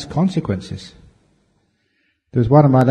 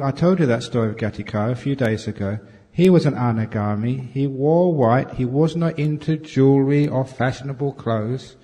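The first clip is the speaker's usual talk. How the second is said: ordinary talk, nothing marked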